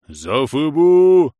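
put-on loud, slow howl of a smoker's old voice; howling old man. A narrator's reading. Scene: old man howls as he advances on them, wildly. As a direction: howl it like a battle cry. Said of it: "взвыл дед страшным прокуренным голосом".

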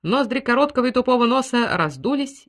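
ordinary delivery, nothing marked

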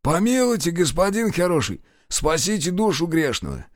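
high, begging groaning plea; pleading wounded man. A narrator's reading. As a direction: beg for mercy, wounded. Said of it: "застонал".

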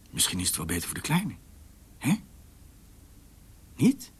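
ordinary talk

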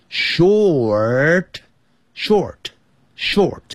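drawn-out "short" with curled r, then repeated; retroflex r. Shown as a demonstration. Teacher drills final r, slow r-coloured "short". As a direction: stretch the r in "short" slowly. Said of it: "那个卷舌音".

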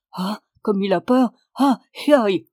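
peasant's fussing exclamations; concerned peasant. A narrator's reading. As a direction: cluck over a frightened child. Peasant clucks over frightened child.

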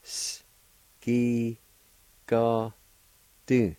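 hissed S alone, then separate held syllables; syllable-by-syllable pronunciation. Shown as a demonstration. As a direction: isolate every sound of the word. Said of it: "It's pronounced like this".

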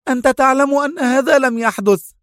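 high-pitched indignant protest; heated, high objection; angry. A narrator's reading. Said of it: "هتفت منى في غضب".

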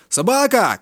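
high shouted name; shouting down the phone. A narrator's reading. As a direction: yell his name down the line. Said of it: "кричать телефон".

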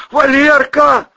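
high, slow, loud call; desperate cry. Acted. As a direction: cry out for help. Drowning man calls.

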